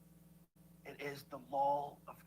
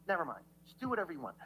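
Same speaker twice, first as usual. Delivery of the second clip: usual speaking voice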